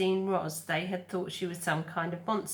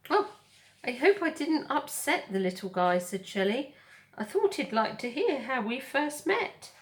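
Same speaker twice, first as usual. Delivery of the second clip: friendly otter voice; 6 semitones higher, 4 dB louder than usual. Acted. Otter character voice.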